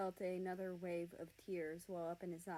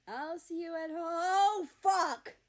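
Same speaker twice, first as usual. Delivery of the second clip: a sudden terrified shout, much louder and higher; panicked scream; 9 semitones higher, 13 dB louder than usual. Acted. America driving, a car barreling toward him, screams in panic. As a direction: scream as the car comes at you. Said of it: "slammed on his brakes".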